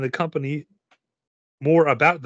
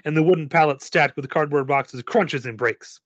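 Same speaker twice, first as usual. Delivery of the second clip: usual speaking voice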